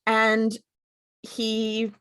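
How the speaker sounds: normal talking voice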